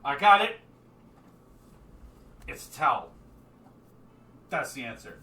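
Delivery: lightly voiced drunk, loud outburst first; drunk guy. Drunk guy calling out his answer, a hint of drunkenness. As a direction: blurt it out as a tipsy tavern riddler. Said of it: "some drunk guy going".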